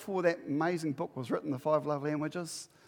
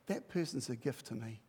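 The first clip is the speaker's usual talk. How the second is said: softer, lower sincere vow; tender; 7 semitones lower, 9 dB quieter than usual. Shown as a demonstration. Spouse vowing to partner, softly. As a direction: say it gently to your spouse. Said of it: "looking spouse in the eyes".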